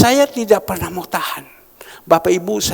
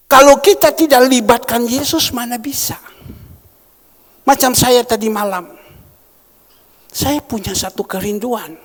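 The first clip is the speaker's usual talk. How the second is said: ordinary talk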